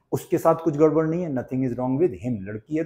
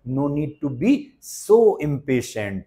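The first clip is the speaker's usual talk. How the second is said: very slow, each word laid out for learners; slow, deliberate classroom model; slow teaching demo; much slower than usual. Shown as a demonstration. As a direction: spell it out slowly for the class. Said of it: "इतना बेताब होने की जरूरत नहीं है".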